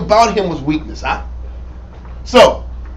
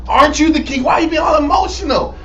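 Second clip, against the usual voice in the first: scolding Jezebel; 6 semitones higher than usual. Retold or imitated. Jezebel scolding soft king.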